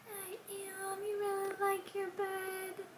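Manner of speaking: high sweet voice for the dog; pet talk. Real, not acted. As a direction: coo at the pet. Talking to the dog.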